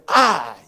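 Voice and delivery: loud, weighty "I"; emphatic answer. Acted. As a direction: land "I" hard, with full authority. Jesus answers the sceptic with authority.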